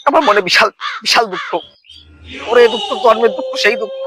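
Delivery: high, hammed-up wail of sorrow; milk the grief like a melodrama; mock lament. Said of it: "আমার কান্না চলে আসে". (নাটুকে উঁচু গলায় দুঃখের বিলাপ)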